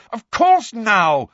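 loud, slow angry snap. A narrator's reading. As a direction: bite it out furiously. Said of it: "said Harry angrily".

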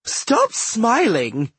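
high, slow, bossy command; ordering. A narrator's reading. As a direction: order him like a bossy sister. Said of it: "she ordered".

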